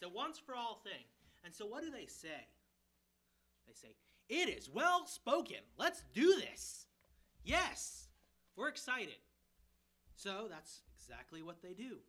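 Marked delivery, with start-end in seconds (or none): 4.3-9.2 loud, eager cheer of the crowd; excited crowd. Retold or imitated. Preacher voicing the excited people, eager and loud.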